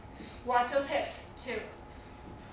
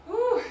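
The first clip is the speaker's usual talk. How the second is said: whew; 5 semitones higher, 4 dB louder than usual. Real, not acted. Exhale while catching breath.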